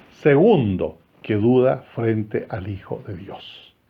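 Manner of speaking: ordinary talk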